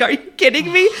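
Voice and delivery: high, loud disbelief; exasperated can't-believe-it; incredulous. Retold or imitated. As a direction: alarm goes off at security, high and loud disbelief. Alarm goes off, disbelief.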